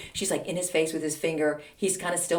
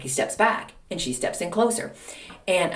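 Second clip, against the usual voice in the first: ordinary delivery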